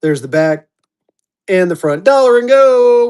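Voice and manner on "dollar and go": high, loud, drawn-out holler; slow sing-out to open the bidding; auctioneer's opening call. Real, not acted. Auctioneer calling the opening bid.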